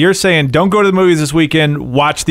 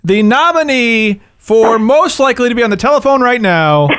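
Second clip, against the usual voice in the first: big drawn-out awards announcer; slow, higher-pitched, building to the name; 7 semitones higher, slower than usual. Acted. Host introducing the film critic as a nominee, announcer style.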